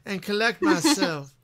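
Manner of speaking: high swooning Southern belle. Pretend. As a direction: play the fainting belle. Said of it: "you got the vapors".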